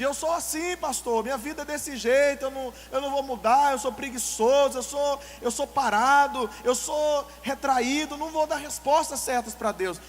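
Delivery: a hammed-up believer refusing to change; mimicking an excuse. Preacher mimics a member declaring he will never change. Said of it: "É o complexo de".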